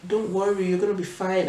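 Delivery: ordinary talk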